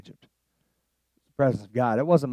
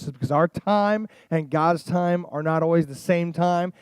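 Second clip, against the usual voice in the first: usual speaking voice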